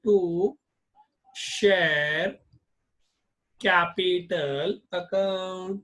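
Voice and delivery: very slow, drawn out entry; slow dictation. Shown as a demonstration. Teacher dictating bank account debit entry slowly. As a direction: dictate each word slowly.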